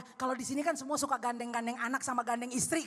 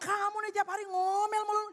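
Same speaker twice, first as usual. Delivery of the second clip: higher-pitched nagging complaint; mock accusation; 5 semitones higher than usual. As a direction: play the fed-up spouse. Acts out a spouse's accusation. (menuduh dengan nada tinggi)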